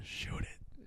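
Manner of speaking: hushed unvoiced whisper. Joking as a football legend whispering in the young player's ear, whispered. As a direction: whisper it like secret advice in someone's ear. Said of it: "whispering in his ear".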